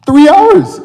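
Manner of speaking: high, loud punchline blurt; exasperated shout. Acted. Storyteller reveals how long the climb took, exasperated shout. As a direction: belt out the punchline in frustration.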